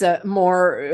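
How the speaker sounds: normal talking voice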